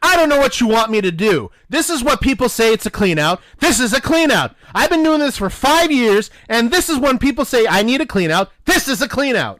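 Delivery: high, loud exasperated re-enactment; worked-up insistence. Retold or imitated. Clerk re-enacting his argument over what a clean out is, worked up. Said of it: "how excited were you?".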